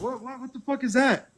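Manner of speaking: high, soft bewildered question; confused recruit, caught off guard; stunned and lost; baffled. Retold or imitated.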